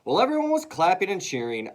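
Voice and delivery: ordinary delivery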